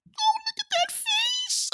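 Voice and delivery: squeaky high cooing; baby talk. Retold or imitated. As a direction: coo like at a baby. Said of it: "Baby Yoda just had me just".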